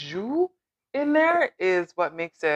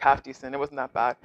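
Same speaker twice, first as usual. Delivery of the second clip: ordinary delivery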